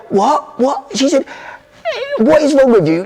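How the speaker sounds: high, incredulous repeated What; outraged mum. Retold or imitated. Mum appalled at dad's pride, high incredulous outrage. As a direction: gasp in disbelief at him. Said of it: "my mum went".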